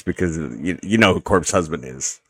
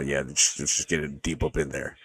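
deep sexy voice; 6 semitones lower, 11 dB quieter than usual. Retold or imitated. Telling Call of Duty teammates to push, in a lower, softer put-on voice. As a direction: purr the line to teammates in a low, soft rumble. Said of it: "You got a sexy voice".

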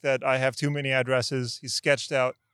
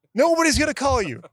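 hammed-up agent yelling, higher and louder; mock shout; 9 semitones higher, 5 dB louder than usual. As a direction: bark it at the caller.

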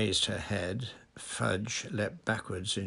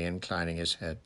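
usual speaking voice

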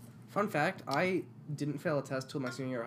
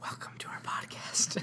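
asmr whisper. Pretend. Host welcoming listeners in an ASMR whisper. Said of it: "Can this be an A S M R podcast?".

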